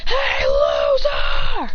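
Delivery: very high, drawn-out jeering call; mock taunting yell. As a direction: stretch it out in a high, teasing holler. Jealous classmate taunting across to him.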